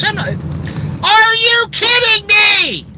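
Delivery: high, loud, drawn-out incredulous outburst; stretched, high-pitched are you kidding me; exasperated disbelief. Real, not acted. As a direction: throw up your hands in disbelief. Exasperated rant at viewers.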